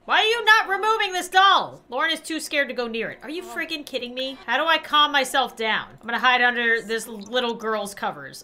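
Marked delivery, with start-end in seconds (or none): none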